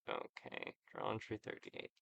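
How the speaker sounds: "journal entry thirty-eight" whispered under the breath; muttered aside. Real, not acted. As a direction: whisper it to yourself while noting it down. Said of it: "You record the rest of his speech".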